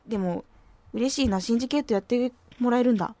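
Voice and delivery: ordinary talk, nothing marked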